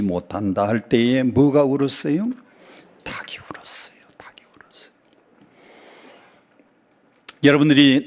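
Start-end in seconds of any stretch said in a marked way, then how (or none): 3.0-4.0 breathy hushed whisper. Acted. Preacher hushes congregation for the rooster's crow, whispering. 4.2-4.9 breathy hushed whisper. Acted. Preacher hushes congregation for the rooster's crow, whispering.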